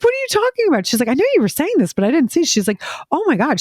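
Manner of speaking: high-pitched, incredulous sister; squeaky disbelief from the sister; shocked sister. Retold or imitated.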